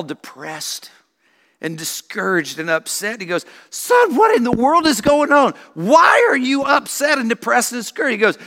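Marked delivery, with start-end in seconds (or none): 3.7-8.1 baffled father. Retold or imitated. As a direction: call out in disbelief, pitched up. Father baffled by sad son.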